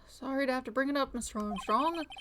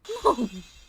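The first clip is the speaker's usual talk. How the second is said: loud far-off mock shriek; a window-breaking shriek; hammed-up scream; 8 dB louder than usual. Chef shrieking when caught. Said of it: "that scream just about broke some windows".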